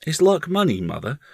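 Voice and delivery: put-on high, timid child's question; a little timid. A narrator's reading. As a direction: ask it as an unsure small boy. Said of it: "he asked a little timidly".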